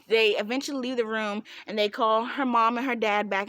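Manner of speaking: usual speaking voice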